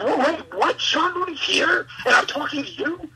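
astonished fan retelling his own reaction; retold disbelief. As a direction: react stunned, voice jumping up into questions. Reacting to news of idol's presence.

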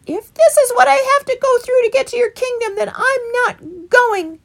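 high, loud, slowing at the end; angry rabbit. A narrator's reading. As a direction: storm off in a huff. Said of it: "Fickle became angry".

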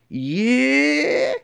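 high, drawn-out agreeable yeah; flirty yeah. Retold or imitated. Woman in stairwell answering the catcall, high drawn yeah. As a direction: answer him with an interested yeah. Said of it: "she's like".

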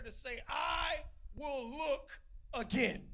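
slow, high emphatic quote; preacher savoring the quoted line; drawn-out emphasis. Retold or imitated. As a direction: stretch out "I will look again", word by word. Dwells on Jonah's prayer line.

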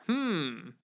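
gentle creature murmur; understanding hmm. Acted. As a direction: hum in quiet agreement. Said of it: "let out an understanding".

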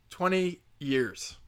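"Twenty years" drawn out slowly; slow deliberate stress on "Twenty years"; slow emphatic. Shown as a demonstration. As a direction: let each word land, slowly. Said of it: "repeated for emphasis".

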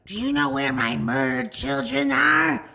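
high, loud, slow goblin imitation; scowling goblin. Crazed woman asking strangers for her children, goblin screech. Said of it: "like a scowling goblin".

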